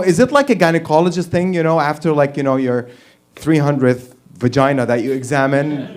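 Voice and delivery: usual speaking voice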